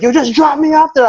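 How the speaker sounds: high-pitched mock insistence; imitated demand. Imitated friend demanding to get out.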